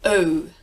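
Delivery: eau said as a pure O; a clean single O, no glide; French eau sound. Shown as a demonstration. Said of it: "That sound is".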